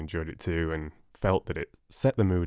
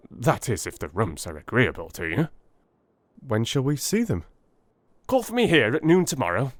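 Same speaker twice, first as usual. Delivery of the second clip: higher, brisk Holmes voice, quick at the end; Holmes voiced; 5 semitones higher, faster than usual. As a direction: play Holmes, eager and businesslike. Holmes settling the lodgings.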